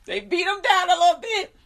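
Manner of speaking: high, loud, laugh-choked voice; amused squeal through real laughter. Real, not acted. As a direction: repeat it while cracking up.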